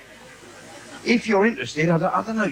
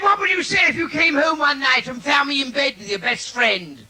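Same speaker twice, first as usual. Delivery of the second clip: mock wife; 9 semitones higher than usual. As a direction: needle him like a taunting wife. Comic voices his wife.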